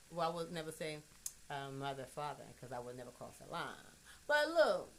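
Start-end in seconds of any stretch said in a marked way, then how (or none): none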